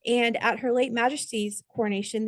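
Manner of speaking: usual speaking voice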